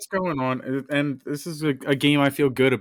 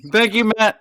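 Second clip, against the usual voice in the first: higher, louder mock gratitude; sarcastic thanks; 7 semitones higher, 7 dB louder than usual. Sarcastic thanks to teasing cohost.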